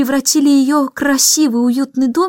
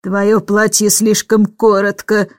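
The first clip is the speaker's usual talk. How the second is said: plain reading voice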